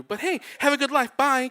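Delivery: loud, high, chirpy imitated goodbye; breezy sign-off. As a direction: dismiss them brightly and hang up. Woman's breezy sign-off before hanging up.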